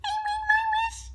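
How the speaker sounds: high playful wish; high squeaky voice. Pretend. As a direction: squeak it like a kid. Reader joins the wishing.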